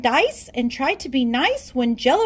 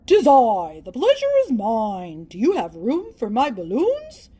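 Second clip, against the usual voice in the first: put-on louder, lower, old-fashioned grand gentleman; a pompous gentleman guest with balloons, lower and loud; grand lowered visitor voice; 5 semitones lower, 4 dB louder than usual. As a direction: announce yourself like a pompous gent. B-Rex arrives with balloons.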